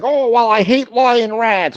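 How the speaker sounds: mock him in a silly high voice; mocking whiny voice. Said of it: "He's like".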